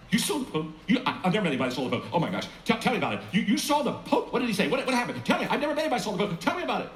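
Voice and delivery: high, excited disbelief; amazed barber. Retold or imitated. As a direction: gush with wide-eyed wonder.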